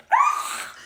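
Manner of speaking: high-pitched frustrated groan; exasperated sigh. Real, not acted. Patient reacting to surprise bill, exasperated. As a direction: let out a high, fed-up noise.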